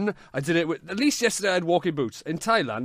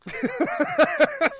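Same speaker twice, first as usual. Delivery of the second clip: burst of laughter; 6 dB louder than usual. Real, not acted.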